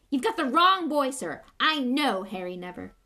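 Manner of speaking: loud, fast rant. A narrator's reading. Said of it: "Hagrid went ranting on".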